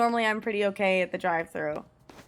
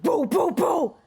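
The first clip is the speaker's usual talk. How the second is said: mock gunshot booms, voice jumping up; imitated gunshots; 6 semitones higher, slower than usual. Streamer pretends to shoot up exam papers, punchy gunshot noises. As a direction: fire off punchy pretend shots.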